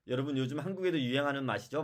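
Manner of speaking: ordinary talk, nothing marked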